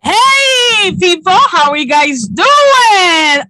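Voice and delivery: high, loud hype-host holler; excited shouted greeting. Real, not acted. Host welcoming listeners back to the show, excited shout. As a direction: greet the crowd at full volume.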